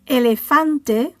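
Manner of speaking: Elefante with FAN stressed; penultimate stress. Shown as a demonstration. Teacher explaining the vowel rule, Elefante stressed on FAN. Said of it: "stress falls on the penultimate syllable".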